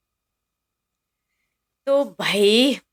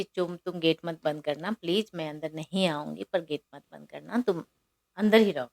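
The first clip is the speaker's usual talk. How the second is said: soft pleading; 7 dB quieter than usual. Retold or imitated. Shilpa gently begs Chum at door.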